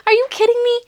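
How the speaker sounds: usual speaking voice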